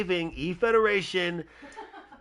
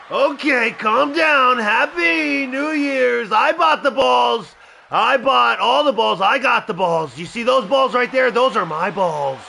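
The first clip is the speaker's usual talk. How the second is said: loud, brash boasting impression, pitched up; Trump voice; 5 semitones higher, 7 dB louder than usual. Streamer voicing his wrestler as Trump, loud and bragging. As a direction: brag like a showman president at the mic. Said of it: "I'll do the Trump voice".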